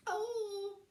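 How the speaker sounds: high, loud wailing witch; Winnie voice. A narrator's reading. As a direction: wail in dismay.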